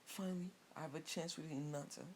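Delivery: high-pitched, lightly acted glee; mock-delighted suitor, voice lifted; happy relief. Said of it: "Kiba would be happy".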